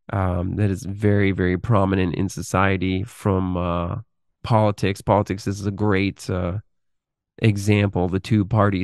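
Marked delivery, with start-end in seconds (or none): none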